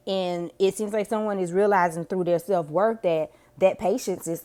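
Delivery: ordinary delivery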